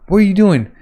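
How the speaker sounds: high, loud acted outcry; alarmed challenge. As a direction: call out in alarm.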